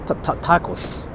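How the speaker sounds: normal talking voice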